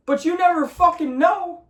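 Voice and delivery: high, forceful insistence; fed-up fan insisting anything can happen; emphatic outburst. Real, not acted. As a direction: drive the point home hard. Emphatic rant point.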